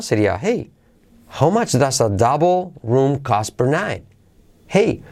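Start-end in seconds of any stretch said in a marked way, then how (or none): 1.4-4.0 slow tune, "double" and "night" lifted; question intonation. Shown as a demonstration. Teacher modelling question intonation, "double" and "night" accented. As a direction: raise "double" and "night" in "how much does a double room cost per night". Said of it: "la entonación, como es una pregunta".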